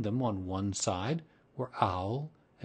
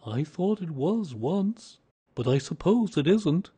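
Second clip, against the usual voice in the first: doleful bear; 7 semitones higher, 6 dB louder than usual. A narrator's reading. Doleful Pooh doubts it's his party.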